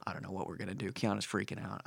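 hushed, low imitation of a worried producer; overheard phone talk. Producer fretting on the phone about the upset star, imitated hushed and low. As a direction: murmur it like a phone call overheard. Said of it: "I overheard him talking on the phone".

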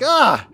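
high, loud cry of alarm; mock shocked gasp. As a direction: yelp as if hit by a bill. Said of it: "We're like".

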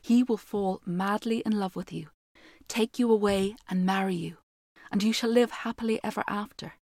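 measured fairy tale telling; story narration. A narrator's reading. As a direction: read it like a storyteller by the fire. Reads fairy tale aloud.

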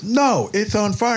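high, slow insistence; insistent messenger. Retold or imitated. Man insisting to Willie, high insistent voice. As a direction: press the point. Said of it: "He goes".

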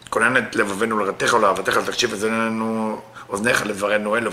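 fast, low murmured davening in Hebrew; rapid prayer recitation. Acted. Teacher opens the Zohar class with prayer, fast devout recitation. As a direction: rattle through the prayer quickly and devoutly.

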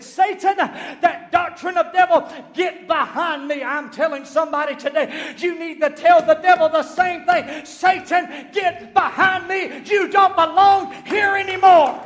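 loud, high, commanding rebuke; full-force preaching. Real, not acted. Preacher teaching people to rebuke Satan, full-force shouted preaching. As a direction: cast the devil out with a drawn-out shout.

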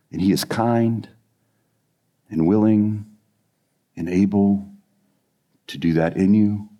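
low, soft and halting; tender reassurance. Real, not acted. Preacher assuring listeners before prayer, tender and hushed.

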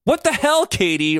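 high, loud startled yell. Acted. Ryan yells at Katie over the candy bar, loud. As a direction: snap at her across the room. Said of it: "Ryan yelled".

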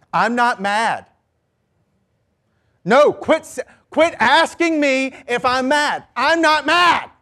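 hammed-up furious insistence on not being mad; mock angry denial. Person sick of being asked if they're mad, angry denial. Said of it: "But that's passive-aggressive".

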